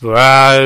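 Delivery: loud, drawn-out "rah" roar; hammed-up crazy yell; mock crazy noise. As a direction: imitate a feigned madman's senseless holler. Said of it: "started making a lot of noise".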